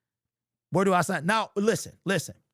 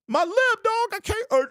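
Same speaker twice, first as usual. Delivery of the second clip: much higher, louder, crying out for Adrian; hammed-up tearful cry for Adrian; Rocky-style wail; 6 dB louder, slower than usual. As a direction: wail like a beaten boxer in a movie. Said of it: "I would have been the Rocky dude".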